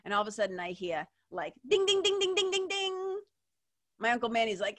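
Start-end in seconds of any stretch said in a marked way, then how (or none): 1.7-3.2 high ringing ding ding ding of a fork on a glass; imitated glass clinking. Uncle calls the table to order, high clinking dings. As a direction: ring it out like a spoon tapping a glass.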